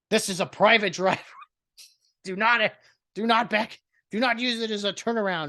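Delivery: a high, mocking voice reading out the sign. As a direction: poke fun at the fussy neighbor's sign, speaking high. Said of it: "little orange cone".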